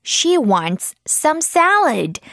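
very slow, clear model line; slow model sentence. Shown as a demonstration. Teacher modelling sentence slowly for children. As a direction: say it slowly so kids can repeat. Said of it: "model sentence for repetition".

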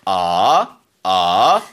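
"아" rising from low up to mid; Mandarin second tone. Shown as a demonstration. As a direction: slide "아" upward like a question. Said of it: "이성은 와이퍼 동작".

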